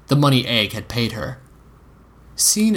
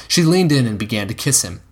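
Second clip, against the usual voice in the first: ordinary delivery, nothing marked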